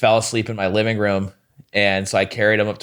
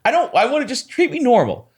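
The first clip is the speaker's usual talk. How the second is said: exasperated plea; 12 semitones higher than usual. Retold or imitated. Pleading with in-character comic.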